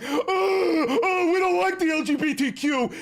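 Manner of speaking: high, loud whiny mock voice; mocking impression. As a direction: sneer it out in a high put-on voice. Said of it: "then going on a camera and going".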